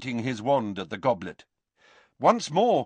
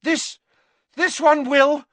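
high, loud, broken urgency; gasping; 13 semitones higher, 7 dB louder than usual. A narrator's reading. Harry promises the professor relief, gasping. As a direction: gasp it out in a panic. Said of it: "gasped Harry".

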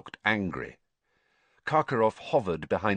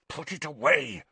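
snarled; 5 semitones higher than usual. A narrator's reading. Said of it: "snarled Snape".

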